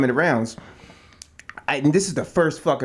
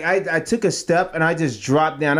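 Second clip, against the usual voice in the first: ordinary talk, nothing marked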